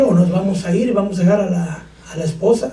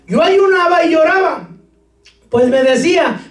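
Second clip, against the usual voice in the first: scripture reading; 8 semitones higher than usual. Acted. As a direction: read the verse aloud with gravity. Preacher reads scripture aloud.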